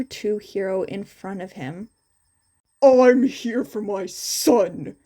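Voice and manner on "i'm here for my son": loud, slow angry retort; shot back. A narrator's reading. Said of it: "Endeavor shot back".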